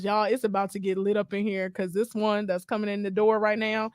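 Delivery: normal talking voice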